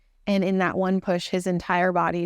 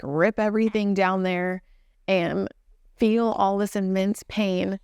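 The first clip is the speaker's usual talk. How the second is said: ordinary talk, nothing marked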